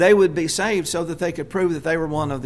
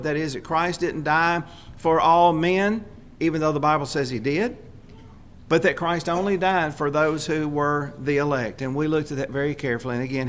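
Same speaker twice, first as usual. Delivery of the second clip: ordinary talk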